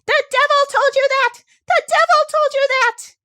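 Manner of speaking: very high, loud raging shriek; a little man stamping with fury; furious shriek. Acted. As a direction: scream it in a rage. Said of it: "shrieked the little man".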